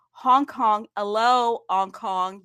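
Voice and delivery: loud mock-local hello; imitated local greeting. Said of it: "that's how they say it".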